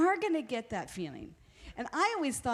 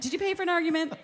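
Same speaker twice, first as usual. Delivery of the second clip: higher, louder joking challenge; mock argument; 7 semitones higher, 6 dB louder, much faster than usual. Wife teasing husband in a staged fight, higher and louder. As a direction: needle him in a play fight. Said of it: "I kinda wanna mock something".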